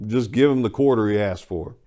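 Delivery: usual speaking voice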